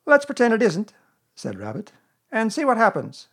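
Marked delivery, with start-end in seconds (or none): 0.1-0.9 high, loud animal character voice; brisk storybook busybody, pitched right up; Rabbit. A narrator's reading. Rabbit answering Pooh's greeting, high character voice. 2.3-3.3 high, loud animal character voice; brisk storybook busybody, pitched right up; Rabbit. A narrator's reading. Rabbit answering Pooh's greeting, high character voice.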